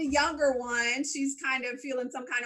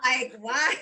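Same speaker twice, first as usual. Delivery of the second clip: baffled laughter. Retold or imitated. Podcaster baffled by Villanelle's hug scene, laughing. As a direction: crack up as you ask it.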